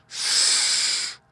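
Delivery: a thin whispered hiss; snake hiss. Shown as a demonstration. Father showing Taffy the plain snake's sound. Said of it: "the plain snake only hisses".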